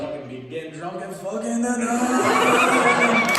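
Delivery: drawn-out hype; hyped-up pitch. Acted. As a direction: sell the party hard, slow and big. Closing bit before applause.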